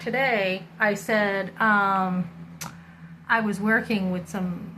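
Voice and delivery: ordinary talk, nothing marked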